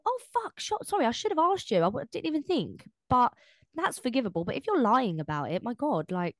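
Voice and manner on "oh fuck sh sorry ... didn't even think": sudden high, caught-out apology; flustered apology. Retold or imitated. Friend acting out realising she forgot to invite someone, flustered and high. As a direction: blurt it out high, caught out and sorry. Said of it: "And you go".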